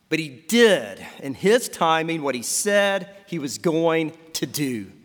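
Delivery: ordinary delivery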